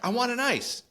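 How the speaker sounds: imitating a child's high-pitched demand; high mock kid voice, insisting; demanding little kid. Little brother keeps demanding ice.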